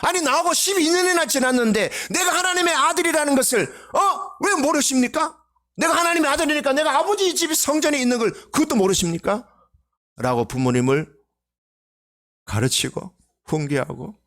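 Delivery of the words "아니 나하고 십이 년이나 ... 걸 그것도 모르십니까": indignant rebuke. Pretend. Imagined son berating his parents, high indignant shout. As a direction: demand it, outraged, up high.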